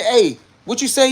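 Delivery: high, loud acted challenge; confronting. Tone confronting the gossiping homie, retold, confronting.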